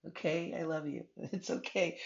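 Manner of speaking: tender, high voice near tears; loving words while really welling up; choked-up comfort with a small laugh; starting to cry. Real, not acted. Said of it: "I really am starting to cry".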